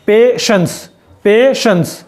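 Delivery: पेशेंस with the t said as श; sh sound in patience. Shown as a demonstration. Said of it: "तो शे की आवाज़ है". (पेशेंस में शे की आवाज़)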